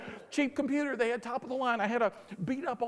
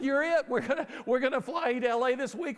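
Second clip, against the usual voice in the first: raised, loud excited news-breaking; excited announcement; 5 semitones higher, 6 dB louder than usual. Acted. Disney caller telling professor he is chosen, excited. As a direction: announce the big win with gusto.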